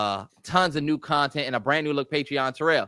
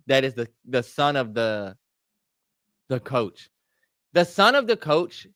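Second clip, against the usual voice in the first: ordinary talk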